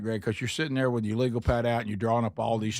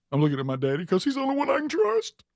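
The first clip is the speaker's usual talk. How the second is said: put-on kid voice; 11 semitones higher than usual. Coach retells the kid's answer.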